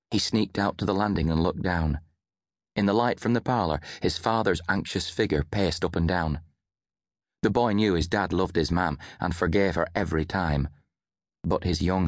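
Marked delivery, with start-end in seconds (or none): none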